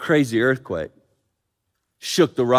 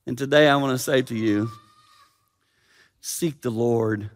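ordinary talk, nothing marked

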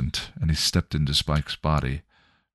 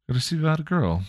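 a much higher, scornful echo of his words; mocking; 11 semitones higher, faster than usual. William mocking Spike's excuse, scornful high echo. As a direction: throw his own line back at him in disbelief.

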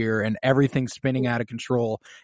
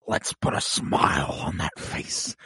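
low, whispery, slow villain voice; Joker; 8 semitones lower, 7 dB quieter, slower than usual. Acted. Fan quoting the villain's famous threat, whispered Joker impression. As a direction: draw it out in a hushed, menacing rasp. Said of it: "he does that whole thing".

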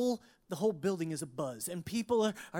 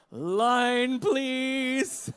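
big, loud stretched-out boom; hammed-up Noah calling grandly for help; 5 semitones higher, 9 dB louder than usual. Pastor replaying dad's stage blunder, booming Noah voice. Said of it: "his most booming Noah-like voice".

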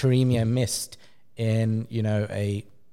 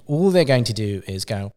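ordinary delivery

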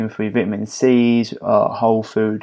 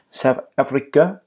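South African accent attempt, pitched up with rising end; poor South African accent; 4 semitones higher than usual. Host joking about his upcoming trip, poor South African accent. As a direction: try a badly faked South African accent. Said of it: "Excuse my poor accent".

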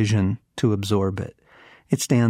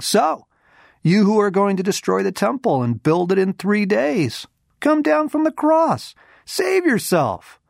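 high, loud jeering taunt; mocking taunt; 11 semitones higher, 4 dB louder than usual. A narrator's reading. Passers-by jeering at Jesus on the cross, high mocking taunt. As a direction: sneer it up at the man on the cross. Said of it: "hurled insults at him".

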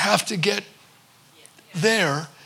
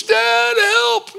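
put-on much higher, a small child's plea; scared little boy; 14 semitones higher, 3 dB louder than usual. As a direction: play a frightened four-year-old stuck in a tree.

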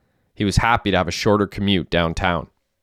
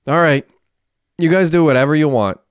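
fed up; 6 semitones higher, 5 dB louder than usual. A narrator's reading. Fed up, then storms off.

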